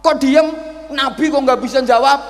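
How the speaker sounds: high, loud, angry scolding; getting angry. Retold or imitated. Old man angrily scolding the silent prophet. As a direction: snap at him for staying silent. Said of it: "Mulai marah dia".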